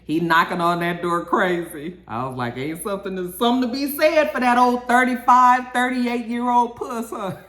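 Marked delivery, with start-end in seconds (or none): 2.6-7.4 high, loud teasing put-on voice, stretched out; hammed-up teasing taunt; mock taunting. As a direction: needle him in a big high sing-song. Mock taunting quote to Canaan.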